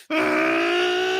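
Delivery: low straining pained roar; anime power-up scream. Pretend. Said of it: "I probably sound like some anime character powering up".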